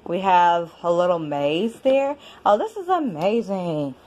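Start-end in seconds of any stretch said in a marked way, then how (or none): none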